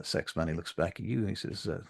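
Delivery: usual speaking voice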